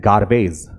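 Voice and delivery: गार्बेज with the R sounded; Indian pronunciation. Shown as a demonstration. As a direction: say गार्बेज the everyday Indian way, R audible. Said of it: "हम लोग कहते हैं".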